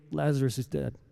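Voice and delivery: quiet, plain statement of fact; just say it simply, softly; said plainly. Retold or imitated. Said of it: "said to them plainly".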